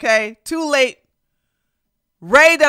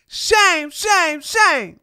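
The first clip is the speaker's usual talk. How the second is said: sing-song shame chant, higher; mock shaming; 8 semitones higher, slower than usual. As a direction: wag a finger with each shame.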